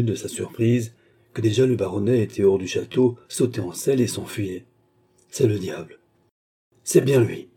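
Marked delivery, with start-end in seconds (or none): 5.3-7.5 understated grumbling servant. A narrator's reading. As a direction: grumble it in short bursts. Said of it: "continua à grombler Jonas".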